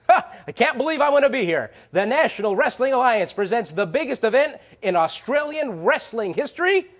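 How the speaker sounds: bursting with hype about the event; excited. Real, not acted. Host hyping the Perth show to fans, excited. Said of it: "I'm just as excited as you are".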